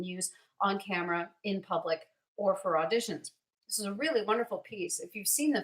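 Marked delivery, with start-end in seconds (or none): none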